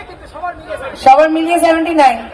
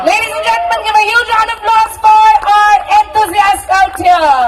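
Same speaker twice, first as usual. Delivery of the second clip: announcer shout; 7 semitones higher than usual. Real, not acted. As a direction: announce it big to the crowd. Calling for applause.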